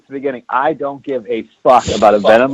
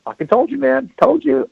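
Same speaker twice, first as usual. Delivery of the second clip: gloating; 12 semitones higher than usual. Retold or imitated. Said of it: "And I'm like".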